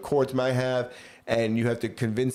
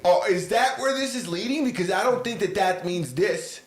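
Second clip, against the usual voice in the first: high, louder disbelieving questions; incredulous; 8 semitones higher, 3 dB louder than usual. Real, not acted. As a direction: ask in raised disbelief.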